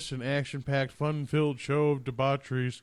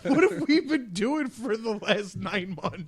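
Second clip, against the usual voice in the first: laughing; 8 semitones higher, slower than usual. Real, not acted. Laughing retort.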